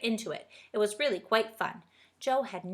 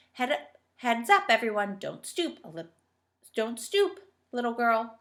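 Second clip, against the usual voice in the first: put-on stern old schoolmistress; 3 semitones higher, 6 dB louder than usual. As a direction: talk down to the children as the headmistress. Dame Slap scolds posture.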